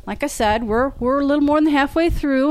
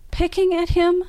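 pitched way up in disbelief; incredulous echo; 7 semitones higher than usual. Acted. Rachel echoes Trent's accusation, incredulous and high. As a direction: throw his word back at him, outraged.

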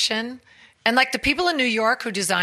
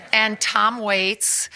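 usual speaking voice

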